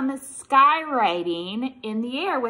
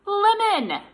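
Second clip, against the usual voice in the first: excited guess; 11 semitones higher, 8 dB louder than usual. Acted.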